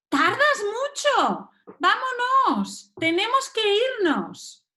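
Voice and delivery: high, drawn-out hurry-up call; calling out impatiently. Acted. Parent calls a dawdler to leave, impatient. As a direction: sing out the words to hurry someone.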